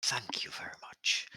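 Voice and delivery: breathy hushed gratitude; whispered thanks. Acted. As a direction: whisper it, pleased.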